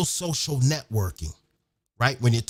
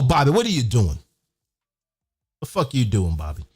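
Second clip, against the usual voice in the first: loud call across the room; 6 semitones lower, 3 dB louder than usual. Real, not acted. MC breaking off to call out to Bobby, loud. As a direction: shout over to Bobby, lower and louder. Calling out to Bobby mid-speech.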